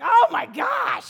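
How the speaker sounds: high, stretched-out shout; mock frenzied yell, loud and slow; wild outburst. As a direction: imitate a kid losing it with excitement. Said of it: "he has moments like the demoniac".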